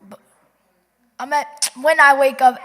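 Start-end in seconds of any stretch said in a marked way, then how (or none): 1.2-2.6 suddenly loud, quick correction; loud self-correction. Real, not acted. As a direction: blurt the fix out loud. Girl corrects herself out loud.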